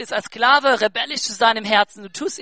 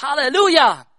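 jubilant halleluja; 4 semitones higher, 7 dB louder than usual. Real, not acted. Preacher's exclamation.

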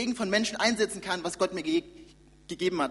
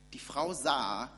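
normal talking voice

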